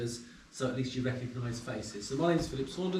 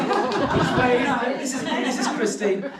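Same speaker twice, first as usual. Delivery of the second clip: laughing mock introduction; 10 semitones higher, 8 dB louder, slower than usual. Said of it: "Just say".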